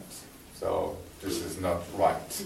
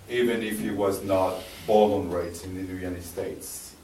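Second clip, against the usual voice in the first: ordinary delivery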